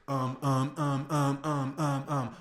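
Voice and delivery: loud, droning string of filler ums; mock a hesitant caller stuck on um; bad tonality. Shown as a demonstration. Coach showing bad phone habits, droning filler ums. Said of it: "Bad tonality indicators".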